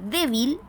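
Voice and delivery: "débil" with the weight on DÉ; stress on first syllable. Shown as a demonstration. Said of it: "penúltima sílaba".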